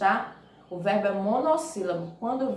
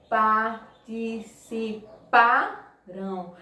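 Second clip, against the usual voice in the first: "participarão" drawn out syllable by syllable, PA strongest; stress on penultimate syllable; much slower than usual. Shown as a demonstration. Teacher shows pupils the strong syllable, slow syllable-by-syllable stress. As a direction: sound out "participarão" very slowly, leaning on PA. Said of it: "A sílaba forte é a penúltima".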